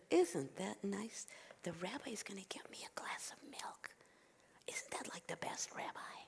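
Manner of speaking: very soft whispered aside; hushed gratitude. Acted. Old woman pleased about glass of milk, hushed.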